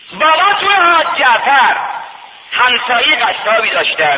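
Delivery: soaring rowzeh-style sermon chant; preaching at full force. Acted. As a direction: lift the journey into a ringing chant. Preacher's high chant again.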